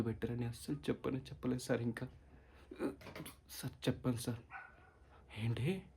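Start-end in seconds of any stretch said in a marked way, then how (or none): none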